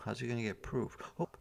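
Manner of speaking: plain reading voice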